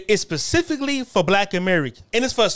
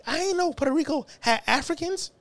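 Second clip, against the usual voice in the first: naive younger self; 10 semitones higher, 3 dB quieter than usual. Retold or imitated. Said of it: "I'm like".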